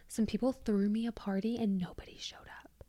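soft unvoiced confession; whisper. Real, not acted. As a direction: whisper it like an embarrassing secret. Confessing embarrassing high school secret.

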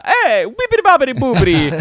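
high, loud, bouncy mock-Italian; hammed-up Italian gibberish, very loud; exaggerated Italian accent. Shown as a demonstration. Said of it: "so Italian when I speak Spanish".

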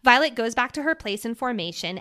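usual speaking voice

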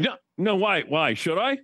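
high, jumpy mock-nervous reply; imitated nervousness. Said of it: "and he's like".

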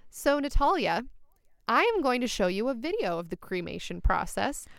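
ordinary talk

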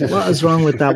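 ordinary delivery

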